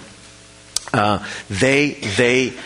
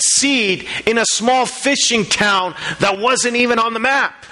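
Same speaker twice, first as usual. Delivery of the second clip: preaching at full force; 11 semitones higher than usual. Acted. Pastor stirring the congregation about a planted seed, preaching at full force.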